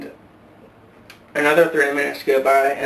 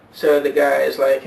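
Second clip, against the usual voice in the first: ordinary delivery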